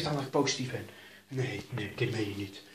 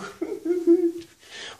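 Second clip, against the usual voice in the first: high mocking laugh; 7 dB louder than usual. Real, not acted. Laugh in odd voice.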